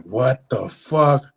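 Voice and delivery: slow, loud stretched what the fuck; drawn-out shock. Retold or imitated. Dom reacting to the fallen tree, slow stunned shock. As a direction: Dom can't believe it.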